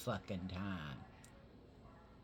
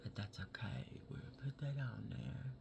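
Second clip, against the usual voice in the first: whispered makeup commentary; 9 dB quieter than usual. Real, not acted. Whispers while blending makeup.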